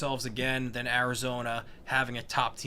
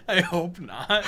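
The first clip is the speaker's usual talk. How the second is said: laughing; 5 semitones higher, 8 dB louder, slower than usual. Real, not acted. Amused at the Long Island joke.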